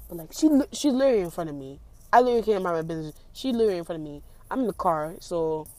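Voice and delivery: rushed. Real, not acted. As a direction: tumble it out in a hurry. Excited about scene outside.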